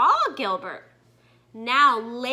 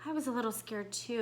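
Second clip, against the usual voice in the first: softer, quicker, a touch sheepish; sheepish admission; 3 semitones lower, 12 dB quieter, faster than usual. Acted. Gilbert admitting fear to his mother, sheepish and soft. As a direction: own up quietly. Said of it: "admitted Gilbert".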